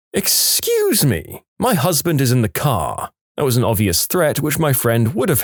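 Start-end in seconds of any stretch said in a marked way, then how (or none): 0.1-3.1 drawn-out high excuse me; huffy lady pulling rank; indignant. Retold or imitated. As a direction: stretch the excuse me, offended.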